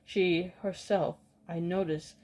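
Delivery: ordinary delivery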